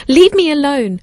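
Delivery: high, loud desperate plea; frightened yell. Acted. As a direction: cry out in terror. Terrified babysitter shouts.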